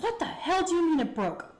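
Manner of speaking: high-pitched, indignant disbelief; retold incredulity. Girl questioning her panicking boyfriend, retold incredulous demand.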